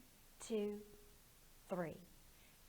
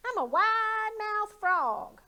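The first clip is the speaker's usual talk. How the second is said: high, loud, slow frog voice; proud little frog showing off; silly high frog boasting; boastful frog; 9 semitones higher, 9 dB louder than usual. Acted. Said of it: "said the wide-mouthed frog".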